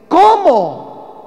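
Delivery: high, loud astonished ¿Cómo?; amazed exclamation. Shown as a demonstration. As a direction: gasp out ¿Cómo? in disbelief. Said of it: "tiene signos de admiración".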